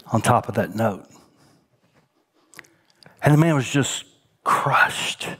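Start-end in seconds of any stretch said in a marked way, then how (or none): 3.2-5.3 a whispered line of heartbreak; whispered sorrow. Real, not acted. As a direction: drop to a grieving whisper. Sad story, man crushed.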